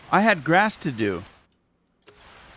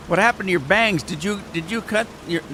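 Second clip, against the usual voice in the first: wife's voice pitched much higher; imitating wife; 7 semitones higher, faster than usual. Husband imitating his wife asking what happened to her bangs. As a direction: mimic his wife, surprised. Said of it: "She says".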